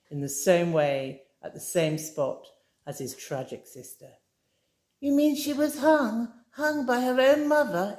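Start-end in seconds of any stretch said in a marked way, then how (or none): 5.0-8.0 put-on high, breathless girlish horror; Amy, shocked. A narrator's reading. Amy reacts to the hanging story, high and aghast. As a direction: voice her as a dismayed young woman. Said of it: "Amy asked".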